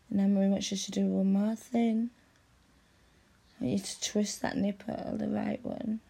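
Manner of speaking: ordinary talk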